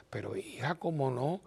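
tender softness. Retold or imitated. Priest comforts the sad wife.

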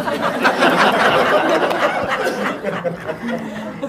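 a short real laugh at the punchline. Real, not acted. Nun laughing as she tells the audience the punchline. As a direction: laugh at the joke on herself. Laughing at her own story.

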